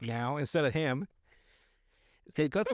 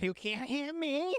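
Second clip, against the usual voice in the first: high, drawn-out singsong taunt; taunting tweaker; 11 semitones higher, slower than usual. Retold or imitated. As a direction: imitate a crackhead goading someone to fight. Voices the skipping, taunting tweaker.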